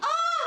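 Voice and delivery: high, loud "oh" with laughter; mock pain cry. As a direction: yelp as if hurt, half laughing. Retold cry of pain raising arm.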